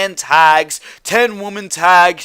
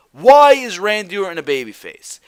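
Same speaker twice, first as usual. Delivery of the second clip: usual speaking voice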